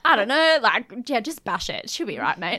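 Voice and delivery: loud laid-back bloke; she'll be right tradie voice; aussie tradesman. Pretend. Jokey Aussie bloke fix-it advice.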